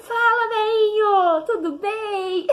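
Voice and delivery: laughing affectionate hello to father; laughing fond greeting. Retold or imitated. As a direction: giggle a fond hello to dad. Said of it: "muito querido".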